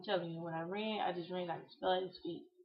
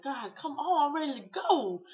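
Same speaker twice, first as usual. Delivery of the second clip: loud, high exasperated begging; impatient plea; 7 semitones higher, 8 dB louder than usual. Retold or imitated. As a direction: plead loudly to be let go, pitch jumping up. Impatient plea to God while dying.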